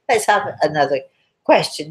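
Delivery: usual speaking voice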